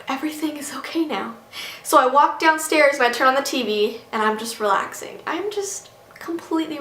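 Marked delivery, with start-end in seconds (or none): 0.1-1.3 sigh, then whisper it; relieved whisper. Retold or imitated. Babysitter once both kids are asleep, sighing relieved whisper. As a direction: let the breath out and whisper with relief.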